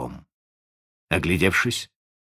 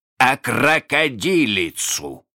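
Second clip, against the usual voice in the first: «крокодилицу» drawn out slowly, pitched up; correcting; 6 semitones higher, 4 dB louder, slower than usual. A narrator's reading. Hook corrects his bosun, stressing «крокодилицу» emphatically. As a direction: hammer out «крокодилицу» syllable by syllable. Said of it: "поправил его Крюк".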